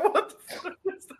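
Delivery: high, laughing disbelief; baffled laughing question. Retold or imitated. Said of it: "I'm like".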